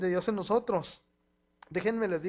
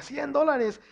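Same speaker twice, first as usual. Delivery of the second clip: loud gloat; 8 semitones higher, 6 dB louder, much slower than usual. Retold or imitated. Child gloating to make his sister cry.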